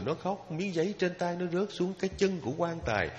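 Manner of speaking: ordinary talk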